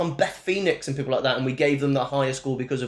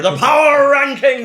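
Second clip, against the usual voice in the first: much higher, louder, slow show-title call; announcer shout; 10 semitones higher, 10 dB louder, slower than usual. Acted. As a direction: bellow it like a wrestling announcer.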